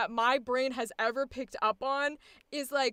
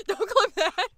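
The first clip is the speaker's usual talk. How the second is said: higher, louder plea through laughter; laughing plea; 6 semitones higher, 7 dB louder than usual. Real, not acted. Streamer begging chat not to clip it, laughing plea. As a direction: repeat the warning, raised and giggly.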